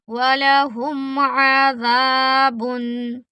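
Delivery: slow tilawat; measured Quranic chant; Quran recitation. Shown as a demonstration. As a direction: chant the ending with drawn vowels. Recites the verse ending.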